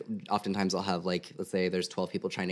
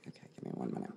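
whispering; 11 semitones higher, 9 dB quieter than usual. Real, not acted. Medium concentrating during reading.

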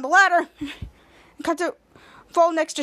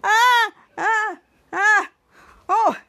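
panicked cries; 5 semitones higher, 4 dB louder, much slower than usual. Retold or imitated. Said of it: "and said".

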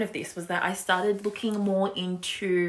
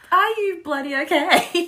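teasing; 10 semitones higher, 4 dB louder than usual. Acted. Playful Are You Okay Day question.

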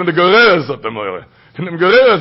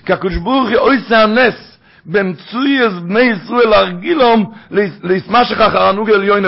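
ordinary talk

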